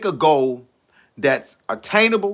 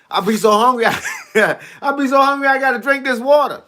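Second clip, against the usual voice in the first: laughing; 5 semitones higher than usual. Real, not acted. Laughing about his hunger.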